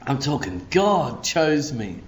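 ordinary talk, nothing marked

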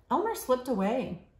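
ordinary delivery, nothing marked

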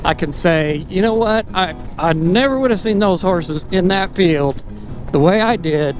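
usual speaking voice

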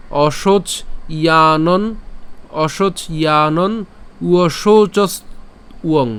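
"অশোচ, ইয়ানন, উয়শৌচস, উয়ং" piece by piece; slowly, a clear pause after each chunk; broken into parts. Shown as a demonstration. Teacher breaking the first part down, slow separated chunks. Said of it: "যেহেতু আমি ভেঙে বলছি".

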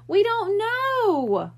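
high, loud, slow wail; a boy wailing near tears. A narrator's reading. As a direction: drag it out in a child's wail. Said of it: "Ralphie wailed".

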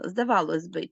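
ordinary delivery

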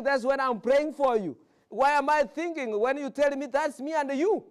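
preaching. Acted. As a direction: promise them from the pulpit. Pastor reassures congregation, raised.

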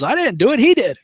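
high-pitched protest passing the blame; high, defensive mock denial; mock innocence. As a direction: play the accused son pointing at someone else. Son blaming idol when accused.

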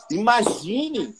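slow, drawn-out invitation; sales prompt. Shown as a demonstration. Salesperson inviting the client to imagine the product, slowly. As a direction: stretch the word out to let them picture it. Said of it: "você pega e fala assim".

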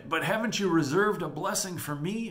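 high, loud acted plea; dramatised upset begging; furious, pleading Esau. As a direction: protest high to a father. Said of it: "Esau is furious".

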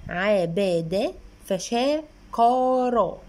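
very slow, each syllable pulled apart; correct slow reading. Shown as a demonstration. Mother demonstrating correct reading from the book, very slow. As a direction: read it out syllable by syllable for a child. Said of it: "دي قراءة صحيحة".

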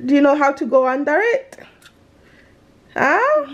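normal talking voice

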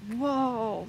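ordinary talk

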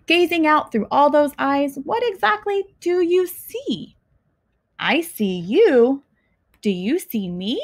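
ordinary delivery, nothing marked

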